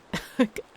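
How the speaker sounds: quick embarrassed laugh; short sheepish laugh; breathy laugh. Real, not acted. Laughs at failed accent.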